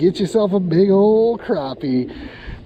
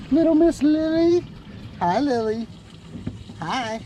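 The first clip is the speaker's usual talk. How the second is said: high cooing voice for the little dog; pet talk; 10 semitones higher, 5 dB quieter than usual. Real, not acted. Talking to the dog.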